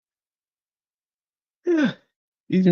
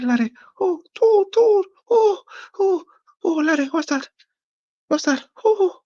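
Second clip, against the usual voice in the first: put-on much higher, panicky old woman calling her laddie; frightened old lady; faster than usual. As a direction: squeal like a scared granny.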